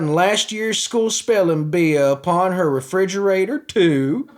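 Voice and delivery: mock the sheriff's sneering country voice; mock Wayne Ivey, country accent. Vlogger mocks the sheriff replying to a commenter, in a country accent. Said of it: "And Wayne Ivey responds".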